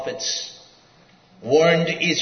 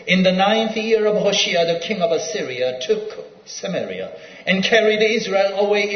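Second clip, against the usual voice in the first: raised, louder pulpit reading of the verse; scripture reading; 5 semitones higher, 5 dB louder than usual. Acted. Preacher reading Kings to the congregation, raised and louder. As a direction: proclaim the Bible passage to the hall.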